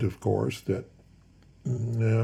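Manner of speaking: ordinary delivery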